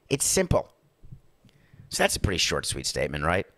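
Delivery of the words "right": usual speaking voice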